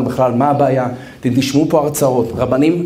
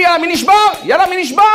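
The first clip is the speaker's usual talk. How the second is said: commanders' taunting yell; 13 semitones higher, much faster than usual. Retold or imitated. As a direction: taunt the freezing soldiers to quit. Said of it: "הם צועקים".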